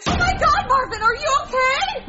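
high, frantic alarm; a hammed-up mother in a fright; panicked mom. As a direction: panic over your injured husband. Mom panics over hurt husband.

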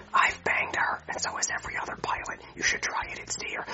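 secretive whisper to a buddy; hushed aside so she can't hear. Acted. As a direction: lean in and whisper the gossip. Pilot confiding about flight attendant.

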